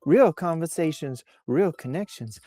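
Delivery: high, soft Southern Belle voice. Pretend. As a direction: read it as a sweet-talking Southern Belle. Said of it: "we did her voice in a Southern Belle voice last week".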